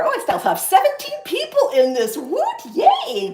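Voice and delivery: squealing high cheer; real delighted excitement. Real, not acted. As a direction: celebrate with a laugh, voice shooting up. Cheering her live viewer count.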